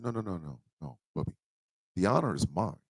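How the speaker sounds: soft, gentle modest reply; quiet, humble refusal of praise. Retold or imitated. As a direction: brush the praise away softly. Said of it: "I said".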